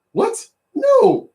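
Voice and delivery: sharp high squeak of disbelief; incredulous what. Real, not acted. Reacting to his ex-wife's claim, a high stunned no. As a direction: jump way up in pitch, can't believe it.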